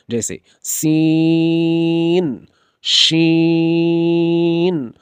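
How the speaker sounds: सीन held long with a hissing s; whistling sibilant. Shown as a demonstration. Teacher models a long whistling सीन. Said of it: "सीटी की आवाज़ निकलती है".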